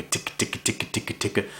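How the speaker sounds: soft ticking feeder call sounds; feeder call ticks. Shown as a demonstration. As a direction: tick it quietly like a feeding duck. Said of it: "you do the feeder call by".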